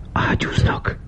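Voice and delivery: voiceless, startled whisper; hushed whisper of alarm. A narrator's reading. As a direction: whisper it urgently in the dark.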